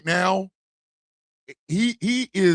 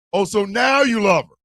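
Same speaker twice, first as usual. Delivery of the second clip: ordinary talk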